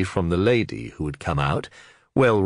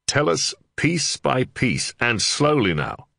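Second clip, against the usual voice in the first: slower, deliberate coaxing; slow and patient; 8 semitones higher, slower than usual. Acted. Under-sheriff urging the Reeve to go slowly, slower. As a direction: spell it out slowly for him. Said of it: "he recommended".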